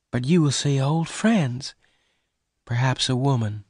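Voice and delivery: high, wistful woman's voice; sad. A narrator's reading. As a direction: say it sadly, high and a little subdued. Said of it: "Bess said sadly".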